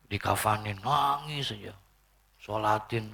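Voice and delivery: normal talking voice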